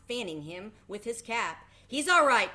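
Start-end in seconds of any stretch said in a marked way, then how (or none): 1.9-2.5 higher, much louder reassurance; anxious cry. A narrator's reading. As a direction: shout over the crowd. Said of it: "cried Mister Zuckerman".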